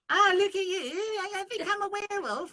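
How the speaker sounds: put-on squeaky English accent, crying out dramatically; silly English werewolf. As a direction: ham up a posh English werewolf in distress. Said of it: "If you were like".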